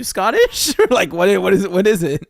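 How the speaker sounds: high, loud laughing questions. Real, not acted. Reader feeling attacked over a Scottish accent, laughing. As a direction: squeal the questions through laughter.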